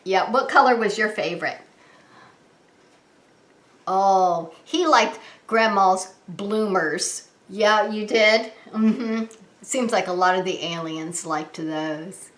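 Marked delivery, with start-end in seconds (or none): none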